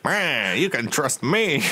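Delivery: a dim, cheery big lug promising loyalty; Krell voice. Acted. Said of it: "that's more of the Krell voice".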